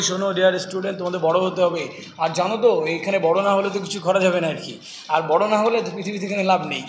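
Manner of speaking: low monotone without intonation; toneless monotone. Shown as a demonstration. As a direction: drone through it like a dull lecturer. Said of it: "এখানে tone নেই, intonation নেই".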